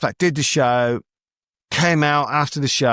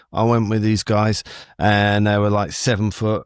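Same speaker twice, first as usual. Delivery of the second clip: usual speaking voice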